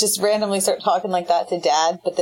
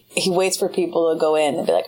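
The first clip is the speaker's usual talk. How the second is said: ordinary talk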